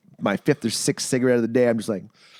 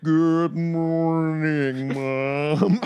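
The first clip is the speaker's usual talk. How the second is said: slow, much higher, dragging greeting; dazed slow-motion; 4 semitones higher, much slower than usual. Retold or imitated. Groggy son greeting his mom in the kitchen, dazed and slow. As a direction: drawl it out like you're half asleep on meds.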